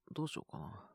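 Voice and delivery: ordinary talk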